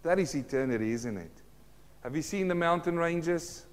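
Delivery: usual speaking voice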